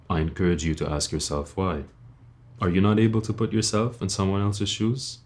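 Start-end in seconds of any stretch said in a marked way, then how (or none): none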